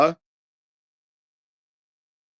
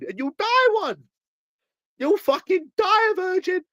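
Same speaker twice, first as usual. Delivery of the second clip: much higher jeering taunt; 13 semitones higher than usual. Real, not acted. Taunting his rival that he'll die a virgin. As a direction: sneer it out high.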